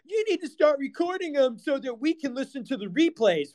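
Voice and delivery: high mocking voice of a demanding fan; mock nagging fan. Streamer imitating fans who demand recordings, high mock voice. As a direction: whine it like an entitled listener. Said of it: "They go".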